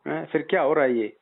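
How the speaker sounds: usual speaking voice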